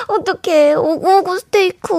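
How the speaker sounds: put-on high cutesy baby talk, 스테이크 lifted; cute pretty voice. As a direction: coo 오구오구 스테이크 sweetly like to a baby. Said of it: "귀엽고 예쁜 목소리로 오구오구 스테이크 해주세요".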